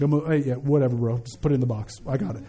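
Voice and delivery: low, offhand mock give-in; meek compliance. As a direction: play a cowed customer brushing it off, low. Imagined buyer giving in to salesman.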